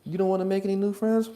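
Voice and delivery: asking parent. Pretend. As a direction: ask a toddler sweetly. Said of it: "That's how my son talks".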